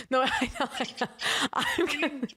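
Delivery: helpless giggles through the words; laughing. Real, not acted. Daughter on call with mom, laughing. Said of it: "Sorry, I'm laughing".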